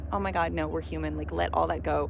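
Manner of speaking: ordinary talk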